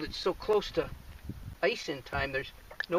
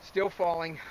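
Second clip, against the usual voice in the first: normal talking voice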